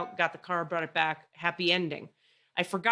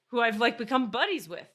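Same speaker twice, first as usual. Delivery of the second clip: usual speaking voice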